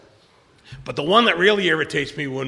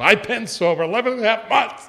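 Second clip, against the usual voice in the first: louder, indignant protest; angry retort; 7 semitones higher, 7 dB louder than usual. Retold or imitated. Joe answering the sponsor who doubted him, angry. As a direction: snap back with wounded pride. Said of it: "just angry".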